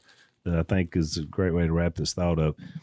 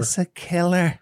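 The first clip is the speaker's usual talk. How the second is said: high, slow mock Irish brogue on the line; 9 semitones higher than usual. Host quoting a fellow preacher in a high mock Irish brogue. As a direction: imitate Jim McGuigan's Irish brogue. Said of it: "With that Irish brogue".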